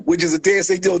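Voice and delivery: ordinary talk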